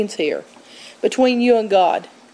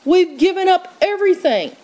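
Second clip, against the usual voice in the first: frustrated Peter; 6 semitones higher than usual. Retold or imitated. Peter exasperated with Jesus about being saved, frustrated. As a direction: throw up your hands, pleading and fed up. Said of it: "Peter just is so frustrated with him".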